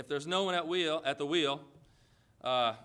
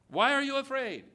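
loud, much higher preacher's challenge; forceful challenge; 8 semitones higher, 5 dB louder than usual. Acted. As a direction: throw the question out hard and high. Repeats Jesus's question as challenge.

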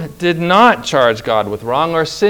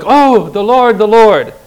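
higher, louder cry of alarm as the frightened people; mock dismay; 5 semitones higher, 6 dB louder than usual. Preacher voicing the people crying out in alarm. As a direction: wail it like a panicked crowd.

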